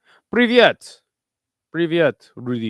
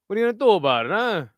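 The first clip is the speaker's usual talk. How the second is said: put-on tough-guy New York accent, higher; 7 semitones higher, 3 dB louder, faster than usual. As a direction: challenge them like a New York wiseguy. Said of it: "I can do the New York guy pretty fucking good".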